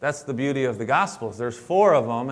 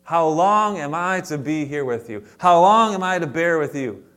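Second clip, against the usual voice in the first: exasperated; 4 semitones higher, 7 dB louder than usual. Retold or imitated. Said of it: "He says".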